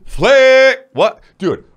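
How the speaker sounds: ordinary talk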